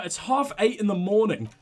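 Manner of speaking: normal talking voice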